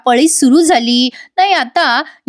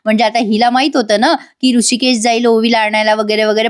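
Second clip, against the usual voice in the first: ordinary talk, nothing marked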